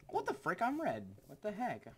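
high, loud burst of surprise; startled disbelief. Real, not acted. Gamer realising he is on red, high startled disbelief. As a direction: squawk it in sudden confusion.

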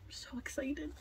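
breathy thrilled whisper; excited whisper. Real, not acted. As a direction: whisper it, barely holding in excitement. Whispered excitement over onesie.

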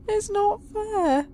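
high, slow, fake-tearful whimper; mock sobbing. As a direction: whine it like a sulking child. Mock sobbing over horse.

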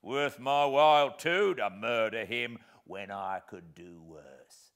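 loud, slow, scornful rant; disdainful. Acted. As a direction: sneer at the idea with contempt. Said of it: "said my convict disdainfully".

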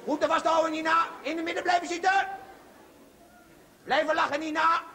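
called out. Real, not acted. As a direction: shout the instruction across. Roept het meisje toe. (roept naar Nina in de kist, luid)

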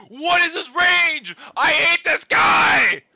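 high, very loud, drawn-out scream; enraged yelling. Real, not acted. Player raging at an opponent's range, enraged yelling. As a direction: yell it at full force in frustration. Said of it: "you're yelling loud enough for my stream to hear you".

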